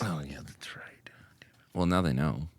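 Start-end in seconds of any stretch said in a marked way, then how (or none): 0.0-1.1 slow hushed whisper. Real, not acted. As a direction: breathe it as a secret. Whispered aside.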